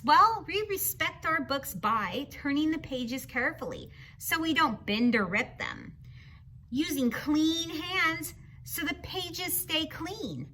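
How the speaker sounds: plain reading voice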